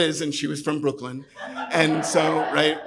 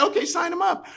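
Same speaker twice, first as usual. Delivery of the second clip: breeze past the answer and close the sale; Liz's cheeky close; 14 semitones higher than usual. Retold or imitated. Liz overriding the customer, high and chirpy.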